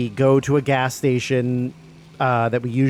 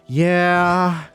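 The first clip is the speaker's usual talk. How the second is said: drawn-out, higher sheepish yeah; mock dejected; 5 semitones higher than usual. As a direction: drag it out, imitating someone defeated. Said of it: "shoulders slumped forward".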